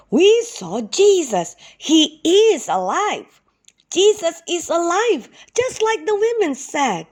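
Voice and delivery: high, loud, eager telling; excited news. Acted. Helpers telling doubting Thomas the news, high and excited. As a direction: rush to convince Thomas.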